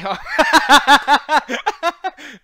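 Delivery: big high cackling laugh; long, high belly laugh; loud burst of laughter. Real, not acted. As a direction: crack up loudly. Reacts to being told off.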